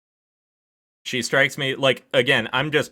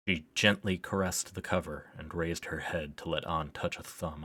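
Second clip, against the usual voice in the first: softer, even reading voice; story narration; 7 dB quieter than usual. Acted. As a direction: read the passage like a storyteller. Reads game text aloud.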